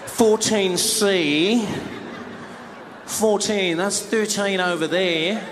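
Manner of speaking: slow, pointed mock puzzlement; drawn-out hinting at nobody in particular; passive-aggressive Australian. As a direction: muse aloud so the seat thief hears, stretching the words. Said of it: "the Australian guy's like".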